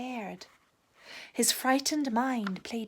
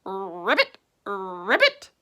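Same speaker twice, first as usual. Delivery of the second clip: low, ghostly calling; eerie low calling; 3 semitones lower, 6 dB louder, slower than usual. A narrator's reading. Mysterious voice calling Rabbit in the mist, low and eerie. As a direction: moan the name spookily.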